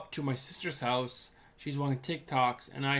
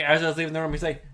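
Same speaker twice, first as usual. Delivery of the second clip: ordinary delivery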